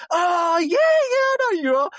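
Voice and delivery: high, loud squeal of recognition, slowing on the end; excited recognition. Retold or imitated. Cabbie realising his passenger is [name], excited squeal. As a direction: gush as it finally clicks who she is.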